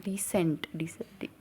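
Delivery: breathy hushed whisper; barely voiced, under the breath. Real, not acted. Vlogger whispering to camera, hushed.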